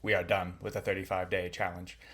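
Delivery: low, soft, measured greeting; hushed, low-pitched show intro; odd put-on intro voice. Real, not acted. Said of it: "I don't know why I'm talking like that".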